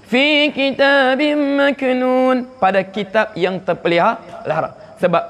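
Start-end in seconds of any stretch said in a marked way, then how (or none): 0.1-2.6 fi kitabim maknun said slowly; recited verse. Shown as a demonstration. Teacher quotes the verse to the class, slow. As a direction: recite the Quranic phrase slowly.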